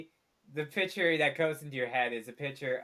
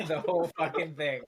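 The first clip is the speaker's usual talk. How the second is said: ordinary talk, nothing marked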